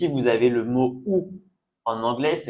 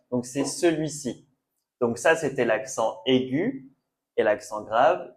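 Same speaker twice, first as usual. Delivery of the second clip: usual speaking voice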